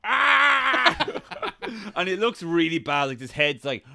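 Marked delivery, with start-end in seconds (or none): 0.0-1.1 short high yelp; mock scream. Describing the show, a hammed-up frozen man's scream. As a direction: yell it like a man whose arm just came off.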